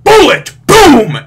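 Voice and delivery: high, loud excited shout; booming hype yell; shouted climax. Retold or imitated. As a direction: yell the punch landing. Climax of the punch.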